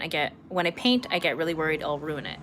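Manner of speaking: normal talking voice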